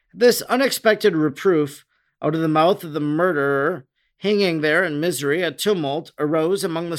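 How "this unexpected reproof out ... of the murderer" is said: plain reading voice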